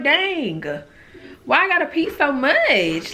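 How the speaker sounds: high, exasperated complaint. Retold or imitated. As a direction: whine about it in disbelief. Exasperated at constant peeing.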